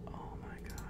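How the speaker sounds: breathless whispered oh my god; defeated whisper. Real, not acted. Vlogger whispering after verification fails.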